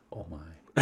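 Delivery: very soft, low murmur; imitated hushed daze; overwhelmed. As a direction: barely voice it, as if stunned. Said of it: "sits down and just says".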